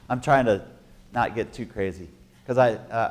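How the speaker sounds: ordinary talk, nothing marked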